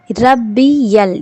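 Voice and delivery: ربی یل slow, ی flowing into ل; linking sound demo. Shown as a demonstration. Teacher isolates the linking sound, slow. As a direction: stretch ربی یل joining the sounds. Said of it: "یا اور لا کو ملانا ہے".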